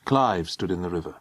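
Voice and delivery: usual speaking voice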